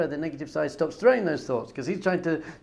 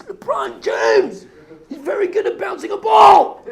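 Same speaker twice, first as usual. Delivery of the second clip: much higher, much louder fan screaming; starstruck shout; 13 dB louder than usual. Acted. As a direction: scream it like a thrilled fanboy at full volume. Fan shouting excitedly about LeBron.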